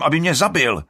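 loud, high angry yell; shouting. A narrator's reading. Alrik shouting he was to be killed. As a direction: yell it in accusation. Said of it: "křičí Alrik".

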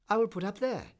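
high, loud exclamation; cried out. A narrator's reading. Swallow spotting the tall column, crying out. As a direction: call it out on spotting the place. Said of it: "he cried".

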